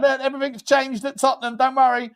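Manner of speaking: high put-on voice mocking her; a hammed-up high impression of Vivian. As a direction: voice the new owner, high and sugary. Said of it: "showing herself around".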